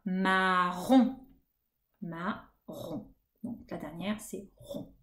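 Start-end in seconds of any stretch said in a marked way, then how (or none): 0.1-1.2 marron in two slow beats, ma then ron; syllables split. Shown as a demonstration. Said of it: "Je fais comme ça". 2.0-3.1 marron softly broken by a pause after ma; syllables split. Shown as a demonstration. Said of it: "découper les syllabes en insistant sur la dernière".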